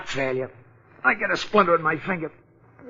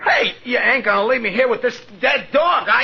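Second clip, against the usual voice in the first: panicked shout; 6 semitones higher than usual. Acted. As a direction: yell it, scared of being left. Left alone beside the grave.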